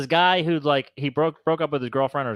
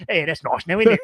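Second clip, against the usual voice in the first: imitated Bale accent; 4 dB louder than usual.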